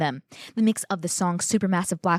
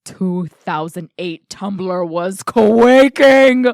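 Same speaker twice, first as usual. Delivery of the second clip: slow, loud, drawn-out excitement; dramatic fangirl emphasis; gushing hype; 8 dB louder, much slower than usual. Real, not acted. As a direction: stretch it out and blow up on the last word. Gushing over an iconic scene.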